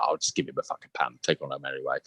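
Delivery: low, soft, muttered; muttered aside. Retold or imitated. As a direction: mutter it to yourself. Resigned thought aside.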